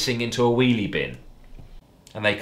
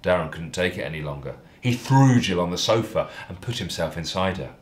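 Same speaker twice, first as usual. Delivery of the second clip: ordinary delivery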